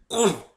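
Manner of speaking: high loud straining grunt; pony stuck in a door, grunting; effort grunt. A narrator's reading. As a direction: push hard, grunt it out. Squeezing through hole.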